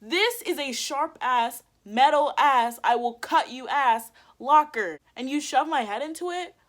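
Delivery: acted-out furious telling-off; retold angry scolding. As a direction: hammer each phrase with outrage.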